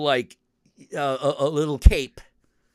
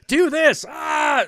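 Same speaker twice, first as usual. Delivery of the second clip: mock urgent yell; 6 dB louder, much slower than usual. Ghost urging player in game.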